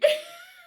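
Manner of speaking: high-pitched burst of real laughter; long high cackling laugh; helpless laughter. Real, not acted. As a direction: crack up uncontrollably. Cracks up at her own story.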